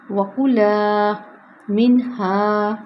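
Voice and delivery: وکلا منہا drawn out with a long kulaa; careful model; slow recitation. Shown as a demonstration. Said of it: "کلا لمبا ہوگا".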